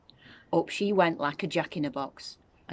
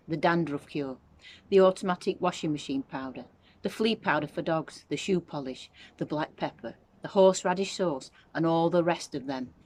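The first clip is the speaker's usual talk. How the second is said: plain reading voice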